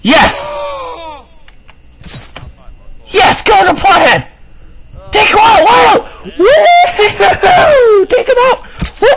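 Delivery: very high, whooping yells; wild excited shrieking. Real, not acted. Gamer cheering as rivals get taken out, wild high shrieking. Celebrating wildly in the game.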